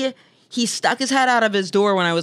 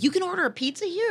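incredulous; 11 semitones higher, 4 dB quieter than usual. Retold or imitated. Incredulous retold reply.

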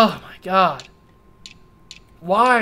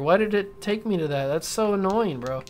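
usual speaking voice

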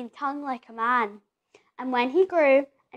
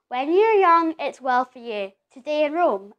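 usual speaking voice

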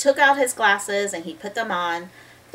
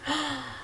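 sharp gasp; 6 semitones higher, 8 dB quieter than usual. Acted. Reader discovers the clue.

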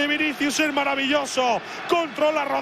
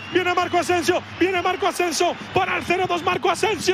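higher, building counterattack call; rising commentary; 3 semitones higher, faster than usual. Real, not acted. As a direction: build the tension as he runs.

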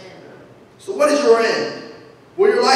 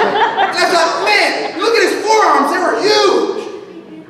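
high, slow, laughing wonder at huge forearms; high delighted childlike marvel; childlike awe; 7 semitones higher, much slower than usual. Retold or imitated. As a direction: gush like a kid at Popeye. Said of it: "I love it".

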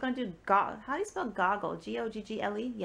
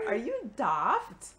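slow mock English accent; playful English-accented question; imitated English accent; slower than usual. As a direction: mimic the English accent, swooping upward. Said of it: "had that little English accent going on".

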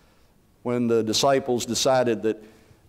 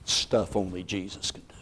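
soft emphasis; 7 semitones lower, 7 dB quieter than usual. Shown as a demonstration. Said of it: "repeated take".

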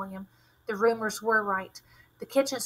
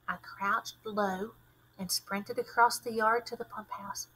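plain reading voice

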